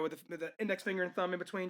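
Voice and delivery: ordinary delivery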